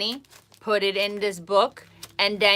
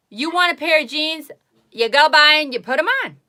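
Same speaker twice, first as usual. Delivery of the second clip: higher, louder exasperated push; 7 semitones higher, 8 dB louder than usual. Real, not acted. Telling excuse-makers to just buy jeans, exasperated. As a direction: brush off the excuse with disbelief.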